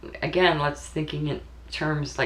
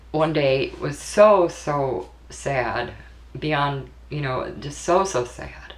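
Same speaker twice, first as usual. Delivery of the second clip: normal talking voice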